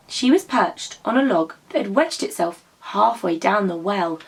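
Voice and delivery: ordinary delivery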